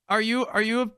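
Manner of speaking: high, halting put-on voice; timid newcomer. New player questioning a stranger, halting and timid.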